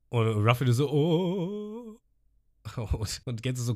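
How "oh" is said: high startled oh; a sudden high little oh; surprised oh. Retold or imitated. Ruffy reacting from the water, high surprised oh.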